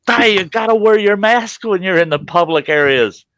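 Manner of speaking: high-pitched imitation of a scolding manager; mock scolding. Building manager telling resident to mask up, mock scolding.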